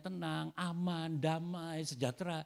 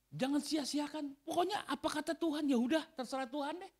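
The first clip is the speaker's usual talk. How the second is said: pleading preacher; 13 semitones higher than usual. Real, not acted. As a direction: urge the church not to waste it. Preaching at full force.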